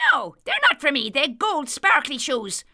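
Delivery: high, quick little leprechaun voice; chirpy fairy shoemaker, proud. A narrator's reading. Leprechaun answers Dan.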